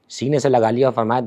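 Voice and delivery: ordinary delivery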